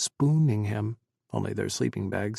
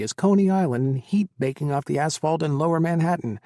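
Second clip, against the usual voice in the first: usual speaking voice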